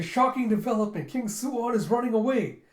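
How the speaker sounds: high, loud commentary burst; shocked announcer. Retold or imitated. Commentator as King Suwon runs away, shocked excited announcing.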